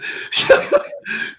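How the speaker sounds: unforced real laugh. Real, not acted. Host amused at leaning on his team, laughing. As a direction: break into a laugh mid-thought.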